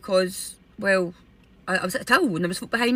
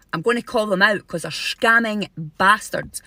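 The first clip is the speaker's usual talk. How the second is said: ordinary talk, nothing marked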